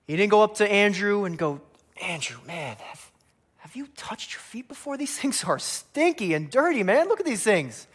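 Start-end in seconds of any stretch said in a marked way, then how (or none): 2.0-7.9 high-pitched, hammed-up disgust; mock disgust. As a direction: start hushed, then rise into squeamish mock complaint. Acting out disgust at stinky feet.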